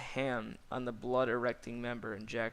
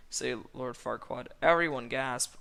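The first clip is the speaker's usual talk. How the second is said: ordinary delivery, nothing marked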